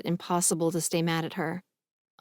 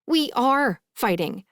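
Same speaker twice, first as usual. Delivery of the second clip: slow, higher, emphatic comeback; insistent; 6 semitones higher, 4 dB louder, slower than usual. A narrator's reading. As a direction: insist on it, leaning on the words. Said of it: "I insist".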